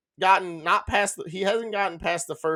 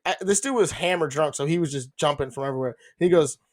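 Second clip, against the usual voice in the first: ordinary talk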